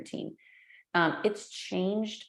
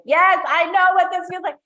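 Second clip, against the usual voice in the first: knowing agreement; 11 semitones higher, 12 dB louder than usual. Retold or imitated. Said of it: "you're like".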